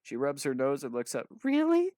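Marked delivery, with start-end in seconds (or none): 1.4-1.9 put-on high, loud girl's "Really?". A narrator's reading. Orphan girl asks Adam if it's true, high voice. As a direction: squeak it high and hopeful. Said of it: "she whispers".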